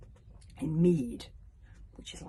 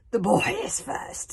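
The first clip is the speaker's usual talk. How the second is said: sneering; 6 semitones higher, 8 dB louder, slower than usual. Acted. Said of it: "sneered Alvin".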